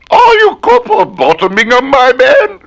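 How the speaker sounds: high, loud prim lady voice; the woman. Acted. Woman asking the repairman about her pots, high lady voice. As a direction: put on a high fussy lady. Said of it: "a woman who has left some pots".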